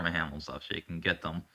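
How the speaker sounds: ordinary delivery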